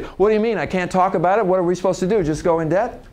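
acted-out exasperated self-justification; defensive protest. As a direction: argue back as if unfairly accused. Acted defensive comeback in argument.

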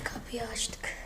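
hushed sneaky whisper. Real, not acted. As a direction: breathe it so nobody hears. Sneaking in for a prank.

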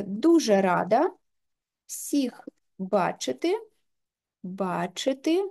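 slow, drawn-out dictation while typing. Shown as a demonstration. Teacher dictating her sample text into the app, slowly. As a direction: spell out each word slowly as she types the text. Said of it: "можемо ввести сюди свій текст".

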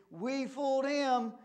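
high, loud gloat; gleeful crowing. A narrator's reading. Pigs celebrating that the wolf is gone, gleeful. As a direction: crow it in triumph.

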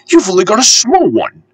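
high-pitched cheeky little engine. A narrator's reading. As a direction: answer back pertly in a high voice. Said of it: "said Percy in his cheeky way".

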